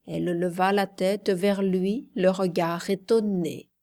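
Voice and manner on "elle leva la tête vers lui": usual speaking voice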